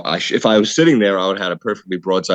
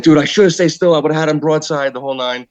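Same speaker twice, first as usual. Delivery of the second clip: frantic outburst; 7 semitones higher than usual. Retold or imitated. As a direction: blurt it out in a panic. Frantic self-blame on the phone.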